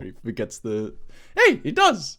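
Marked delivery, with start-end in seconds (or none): 1.3-2.2 much higher, louder outburst; indignant shout. Real, not acted. S0 insists Matt forgets, shouting over cohost's jab. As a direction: yelp it back in protest. Defending a jab amid teasing.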